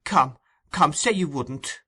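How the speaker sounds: sweet tender tone. A narrator's reading. Nancy coaxes Sykes with sweet tender pleading. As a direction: coax him sweetly. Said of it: "sweetness of tone even to her voice".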